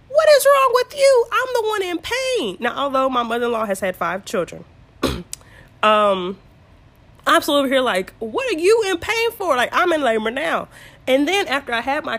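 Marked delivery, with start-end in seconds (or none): 0.1-2.6 high, loud, indignant retort; exasperated outburst. Retold or imitated. Laboring woman thinking at mother-in-law, exasperated. 8.2-10.7 indignant complaint. Retold or imitated. Acted complaint at mother-in-law.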